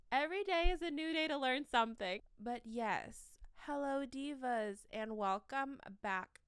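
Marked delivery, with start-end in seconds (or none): 0.1-2.2 higher, louder and fast, cheering herself on; bright burst of self-motivation; excited pep talk. Real, not acted. Upbeat self-encouragement after failing.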